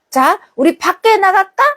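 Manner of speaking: put-on a mother tempting the child outside; lightly voiced cheerful mother; cheerful mom. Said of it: "그러면 어떻게 해요?".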